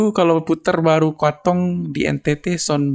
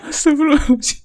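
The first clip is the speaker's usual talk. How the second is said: laughing through pain; 6 semitones higher than usual. Real, not acted. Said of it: "sakit perut gua".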